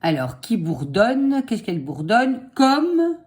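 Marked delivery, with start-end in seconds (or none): 2.6-3.2 dictating. Shown as a demonstration. Dictating slowly.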